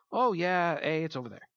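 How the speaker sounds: badly faked, low-key Canadian accent. Local giving lost travelers directions, in a bad Canadian accent. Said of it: "that's my bad Canadian accent".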